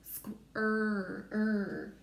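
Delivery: squ then er split apart; chunking squ and er. Shown as a demonstration. Teacher splitting squirt into chunks. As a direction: break it into squ and er. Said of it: "I hear that hunk and chunk".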